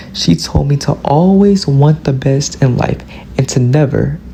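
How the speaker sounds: ordinary talk, nothing marked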